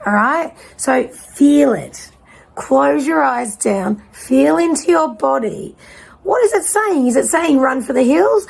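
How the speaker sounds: slow, gentle guiding voice; guided body check-in. Acted. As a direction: lead them into feeling their body.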